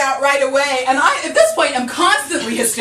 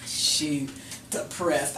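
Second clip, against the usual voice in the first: much softer and lower, resigned; deflated mutter; 9 semitones lower, 11 dB quieter, slower than usual. Real, not acted. Comic resigning herself to the doctor's diagnosis, deflated and soft. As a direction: let the air out, defeated.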